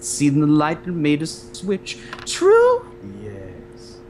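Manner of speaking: slow, theatrical villainous sea witch; silky, over-dramatic witch making her pitch; in character. Shown as a demonstration. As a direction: draw it out grandly, swelling loud then dropping away. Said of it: "a little bit of a lower tone".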